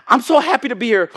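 high, loud, gushing phony delight; fake enthusiasm. Preacher acting a hypocrite at church, fake enthusiasm. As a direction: gush with put-on happiness. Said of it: "That's hypocrisy".

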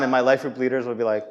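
ordinary delivery

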